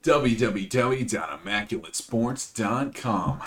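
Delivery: slow, hushed website plug; soft announcer voice. Acted. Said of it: "announcer voice".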